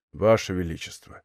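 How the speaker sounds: ordinary delivery, nothing marked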